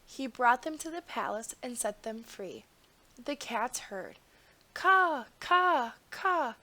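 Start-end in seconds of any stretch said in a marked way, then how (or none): 4.7-6.6 high, loud crow caws. A narrator's reading. Crows cawing down at the cats, high and loud. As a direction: caw out like crows.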